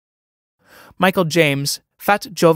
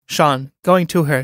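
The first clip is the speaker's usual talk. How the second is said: ordinary talk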